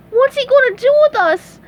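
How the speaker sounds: high, loud panicked question; high cartoon panic; frightened cry. A narrator's reading. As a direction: cry out in alarm. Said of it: "cried SpongeBob".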